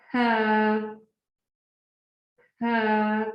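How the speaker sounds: हा modelled breathy and clear; Urdu letter ha. Shown as a demonstration. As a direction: breathe out हा slowly. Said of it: "इस तरह अदा करेंगे". (हा को इस तरह अदा करें)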